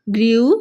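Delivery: grew loud, oo drawn out and rising; grew, oo held for contrast; long oo in grew. Shown as a demonstration. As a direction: stretch the vowel of grew. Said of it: "ऊ का sound difference आ रहा है".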